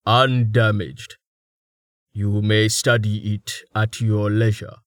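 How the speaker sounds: slow, measured character voice; solemn giant. A narrator's reading. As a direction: speak very slowly and deliberately.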